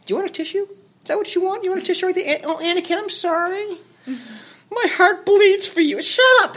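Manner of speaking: very high, babying fake sympathy; hammed-up tearful consoling in a squeaky-high pitch; mock pity. Mock consoling a whiny Anakin.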